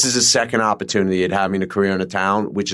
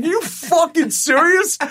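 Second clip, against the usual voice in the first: much higher and slower, drawn out; milk the astonishment even more; stretched disbelief; slower than usual. Retold or imitated. Doug walking up, repeating it in slower, higher disbelief. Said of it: "He walked up, he's like".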